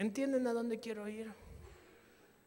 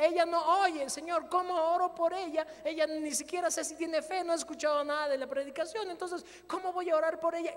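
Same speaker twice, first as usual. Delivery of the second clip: much higher and louder, urgent; a preacher's frantic prayer over a deaf woman; anxious plea; 7 semitones higher, 6 dB louder than usual. Retold or imitated. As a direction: plead with God in rising panic. Panicked inner plea to God.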